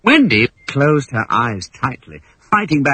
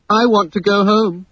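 sniffing; 9 semitones higher, 3 dB louder than usual. Acted. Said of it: "sniffed John".

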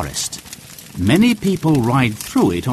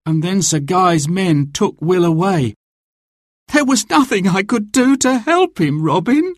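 high, helpless plea; distressed, near tears; 8 semitones higher than usual. A narrator's reading. Much confesses helplessness to Robin, high and close to tears. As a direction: let the pitch climb in distress. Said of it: "Much started to cry".

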